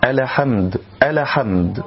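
"الحمد" as a-la-hamd, extra vowel after l; bounced lam. Shown as a demonstration. Teacher imitates a mistake for learners, qalqala on the lam. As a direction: release the l of "الحمد" with a little echo. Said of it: "قلقلة اللام الساكنة من كلمة الحمد".

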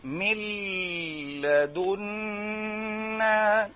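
sung out, stretched very slowly; wrong drawn-out reading. Shown as a demonstration. Teacher showing a wrong reading, sung slowly. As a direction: drag the vowels like a drunk reciter. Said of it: "خطأ".

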